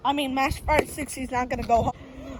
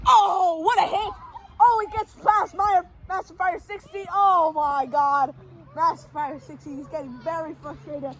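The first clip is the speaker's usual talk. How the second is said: excited call easing off; play-by-play; 7 semitones higher, 5 dB louder than usual. Acted. Announcer calling a big hit, excited play-by-play. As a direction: exclaim at the hit, then settle into commentary.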